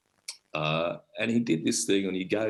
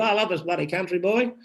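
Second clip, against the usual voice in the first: put-on higher, louder fond old bloke; Ron Palmer; 10 semitones higher, 3 dB louder than usual. Old Ron telling the host why he laughs, high and fond. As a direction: beam it out like a proud old digger. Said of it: "He said".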